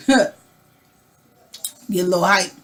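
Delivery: talking around Tic Tacs; mouth full. Real, not acted. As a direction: mint in the mouth while speaking. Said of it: "I got Tic Tacs in my mouth".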